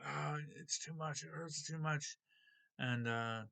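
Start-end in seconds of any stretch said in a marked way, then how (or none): 0.0-2.2 higher-pitched mild mock scream of pain. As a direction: imitate crying out in pain without real volume. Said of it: "and she's screaming".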